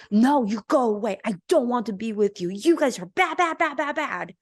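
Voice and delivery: mock rejecting mother. Mother rejecting the child emotions.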